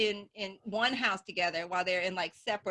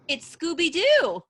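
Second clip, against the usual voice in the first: punchline reveal; 6 semitones higher, 9 dB louder, slower than usual. Real, not acted.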